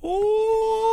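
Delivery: very high, sustained "ooh"; emphatic "ooh". Shown as a demonstration. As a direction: hoot it high, reacting to a burn.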